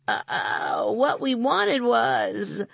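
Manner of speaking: hesitant, slow, trailing stammer; flustered stalling. Acted. Boy answers the scowling woman, flustered stalling. Boy's brain seizes up.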